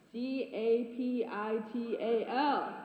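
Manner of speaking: each letter slow and deliberate; spelled out slowly. Acted. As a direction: spell it out, one letter at a time. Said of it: "this is a capital offense".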